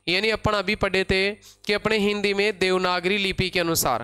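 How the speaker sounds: ordinary talk, nothing marked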